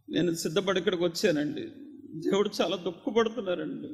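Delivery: ordinary delivery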